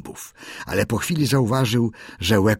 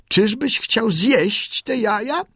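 high, slow, wondering bird voice; bird asking; 13 semitones higher, slower than usual. A narrator's reading. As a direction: ask it with naive alarm. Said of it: "spytał Darci".

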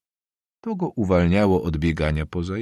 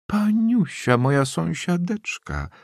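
high, drawn-out delighted greeting; old woman overjoyed to see her neighbour; joyful calling out; 11 semitones higher, slower than usual. A narrator's reading. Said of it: "zawołała staruszka z niekłamaną radością".

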